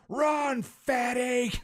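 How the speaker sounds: high-pitched drawn-out holler. Retold or imitated. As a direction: holler it as if out a car window. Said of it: "while hollering out the open window".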